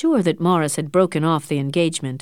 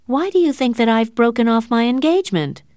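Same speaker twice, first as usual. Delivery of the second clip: raised, higher, louder indignant question; angry; 6 semitones higher, 5 dB louder than usual. A narrator's reading. Catherine rounding angrily on her meddling aunt. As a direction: snap it back at her aunt, hurt and angry. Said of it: "said Catherine angrily".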